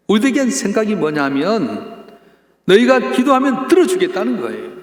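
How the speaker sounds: loud, high sermon climax; full-force preaching. Acted. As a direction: preach it out to the congregation.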